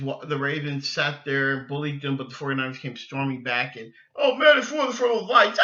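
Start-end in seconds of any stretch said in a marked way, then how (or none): 4.2-5.6 higher, louder whiny excuse; mock the excuse-makers in a whine. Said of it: "I ain't hearing nothing about no lights".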